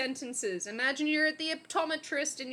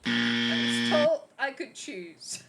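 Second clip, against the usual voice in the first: very low, slow, loud drone; slow, deep mock complaint; mock protest; 14 semitones lower, 5 dB louder, slower than usual. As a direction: drag it out far below your usual pitch. Mock protest, far lower droning voice.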